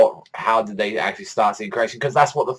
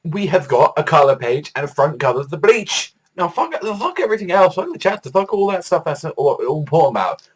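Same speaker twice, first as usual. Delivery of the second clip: excited; 8 semitones higher, 3 dB louder than usual. Real, not acted. Hyped about Bleach color cover.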